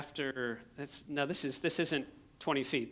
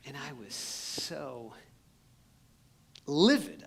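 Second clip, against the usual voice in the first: livid; much slower than usual. Retold or imitated. Missionary telling the crowd he was livid. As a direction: voice swelling on livid. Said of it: "I was livid at these guys".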